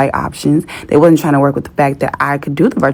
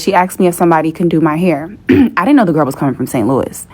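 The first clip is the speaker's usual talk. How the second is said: usual speaking voice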